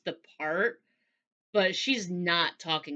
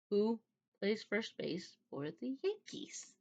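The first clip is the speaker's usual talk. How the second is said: slow, hushed retold question, rising at the end; quietly persistent; 2 semitones higher, 10 dB quieter, slower than usual. Suspicious man repeats his test question, quietly insistent. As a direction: ask it softly and deliberately, word by word.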